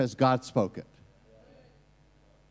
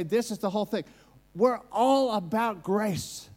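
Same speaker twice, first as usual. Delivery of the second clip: preaching at full force; 5 semitones higher than usual. Acted. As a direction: press every point home to the hall.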